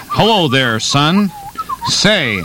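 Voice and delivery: lightly voiced hip undercover cop; undercover cop act. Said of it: "I walk up to you and I say".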